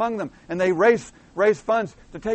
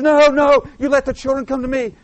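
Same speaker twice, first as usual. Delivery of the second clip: loud, high-pitched indignant rebuke; indignant Jesus; 7 semitones higher, 8 dB louder than usual. Retold or imitated. Preacher voicing Jesus rebuking his disciples, indignant and loud. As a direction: burst out in raised-voice indignation. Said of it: "And Jesus gets indignant".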